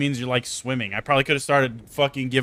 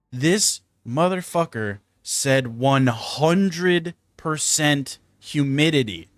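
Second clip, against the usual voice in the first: slow disbelief; 2 semitones higher, much slower than usual. Retold or imitated. Reading the weather app.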